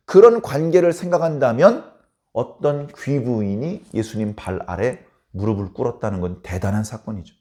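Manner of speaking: usual speaking voice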